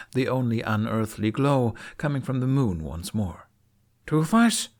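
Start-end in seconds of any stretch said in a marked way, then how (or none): 4.1-4.7 high rising call, louder. Acted. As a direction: call into the dark for his dragon. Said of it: "Hiccup called".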